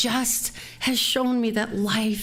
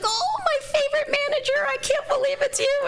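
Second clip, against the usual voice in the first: high-pitched squeal of delighted recognition; gushing greeting. Retold or imitated. Former employee hugs her old manager, gushing in high-pitched delight. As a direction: imitate an overjoyed old colleague, voice way up high.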